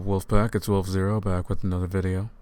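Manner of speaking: usual speaking voice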